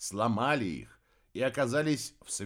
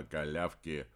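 low gruff beast voice; 8 semitones lower, 7 dB quieter than usual. Acted. Marli's voice.

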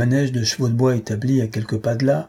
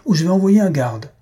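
loud, higher barked command; shouted order; 7 semitones higher, 5 dB louder than usual. A narrator's reading. Owner ordering the crowd from the door: loud shouted command. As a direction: clear the doorway with a shout. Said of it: "cria le maître de l'établissement".

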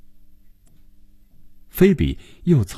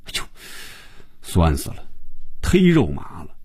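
cringing. A narrator's reading. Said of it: "他就浑身哆嗦了几下".

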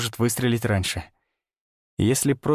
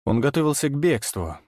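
ordinary delivery

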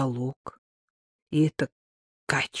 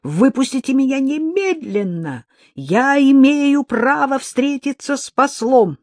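yelling; 9 semitones higher, 5 dB louder than usual. A narrator's reading. Woman yelling at her captors again. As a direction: yell it with renewed force.

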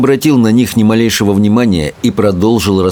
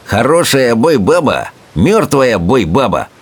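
smirking bully; 3 semitones higher than usual. A narrator's reading. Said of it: "ухмыльнулся сопляк-мордоворот".

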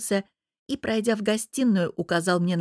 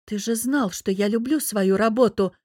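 usual speaking voice

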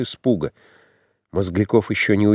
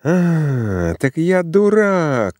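higher, slow, bitter cry of insult; furious outburst; 5 semitones higher, much slower than usual. A narrator's reading. Rejected suitor shouting at Zina in rage. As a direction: spit out the word дурак as a wounded accusation. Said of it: "кричал он".

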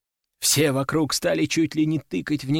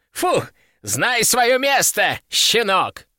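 high, slow, drawn-out scorn; gloating put-down; 7 semitones higher, much slower than usual. A narrator's reading. Old collector tells the beaten pup his place, high gloating.

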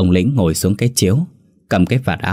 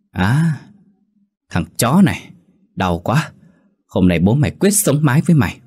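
put-on angry drunk; 5 semitones higher than usual. A narrator's reading. Drunk hit, threatens back.

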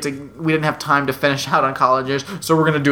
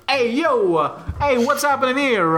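higher, hammed-up Italian mobster voice; mock Italian tough guy; 9 semitones higher than usual. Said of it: "he's like".

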